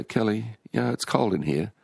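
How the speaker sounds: lightly acted complaint to a pet; calling the dog. Voices calling to his dog.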